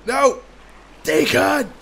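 high, loud outcry; dismayed yell. Real, not acted. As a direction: cry out in alarm and frustration. Loses bike, yells at character.

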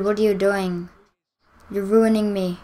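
ordinary talk

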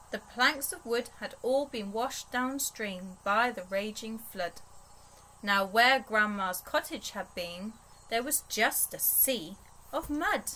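usual speaking voice

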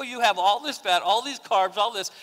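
mock-incredulous teasing; teasing mockery. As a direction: needle him about the Diet Pepsi. Teasing brother over Diet Pepsi.

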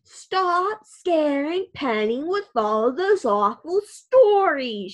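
loudly telling grandpa off, slow and firm; loud scolding. A narrator's reading. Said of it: "she says loudly".

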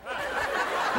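a short unvoiced laugh; breathy laugh. Real, not acted. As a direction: laugh quietly at the memory. Amused at overheard ladies.

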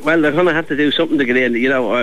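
usual speaking voice

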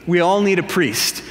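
ordinary talk, nothing marked

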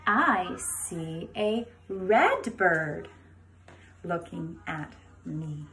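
slow, sing-song picture book reading; reading to a toddler. Acted. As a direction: read it slowly to little kids. Reads book to kindergarten.

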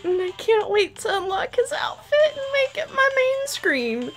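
rising into a high tearful squeal; emotional wreck. Real, not acted. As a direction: gush over him, voice climbing as it overflows. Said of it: "I'm a wreck".

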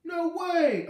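very high, loud scream; shocked shriek of outrage; inner scream. A narrator's reading. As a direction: scream it in disbelief. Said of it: "I screamed inside of my head".

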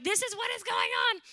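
loud, high-pitched exasperated cry; mock venting outburst. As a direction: act out someone blurting their troubles. Acts out venting to others.

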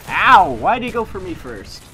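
loud, high ow then complaint; pained yell. Real, not acted. Hit by an enemy in the game, a loud pained yell. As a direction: cry out as you get hit.

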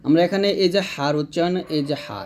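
normal talking voice

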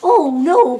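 high, loud wail of dismay; loud storybook panic; cried out in dismay. A narrator's reading. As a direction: cry out in alarm. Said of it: "cried Jake".

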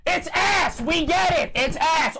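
high-pitched exasperated shouting; angry screaming. Real, not acted. Streamer to chat complaining about the mic, screaming. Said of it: "It's ass when I scream".

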